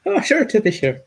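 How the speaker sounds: high, hammed-up Irish lilt; very Irish accent. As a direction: put on a stock Irish brogue, pitched high. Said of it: "That's a, very Irish".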